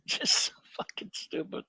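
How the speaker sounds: real disgusted whisper under the breath; exasperated whisper. Real, not acted. Reviewer despairing at a bad film, exasperated whisper. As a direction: whisper it in weary disbelief.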